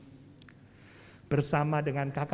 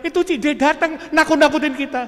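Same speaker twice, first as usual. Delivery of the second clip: frightened sibling; 9 semitones higher, 10 dB louder, faster than usual. Retold or imitated.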